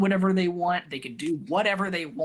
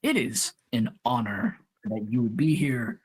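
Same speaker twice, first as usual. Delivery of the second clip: Tyment's grave voice; 9 semitones lower than usual. Acted. GM as Tyment honoring the recruits, lower and solemn. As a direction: speak it as a weighty honor.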